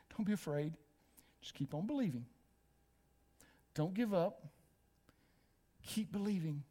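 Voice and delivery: low, soft encouragement with pauses; gentle reassurance. Acted. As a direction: reassure softly, lowering the voice. Jesus reassuring the grieving father.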